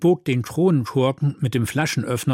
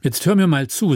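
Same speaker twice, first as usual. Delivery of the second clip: higher, firm call for attention; raised-pitch admonishment; stern demand; 6 semitones higher, 4 dB louder than usual. A narrator's reading. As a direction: demand to be listened to. Paul confronts Thomas, demanding attention.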